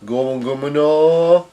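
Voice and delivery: mock battle cry with a long held "no"; attack move call. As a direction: call out an anime attack, stretching the end. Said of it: "uses a move called".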